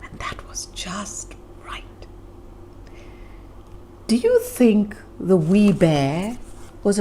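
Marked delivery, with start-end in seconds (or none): 0.0-2.1 whisper, much softer; a secret, contented whisper; hushed whisper. A narrator's reading. Narrator whispering that the bed was just right.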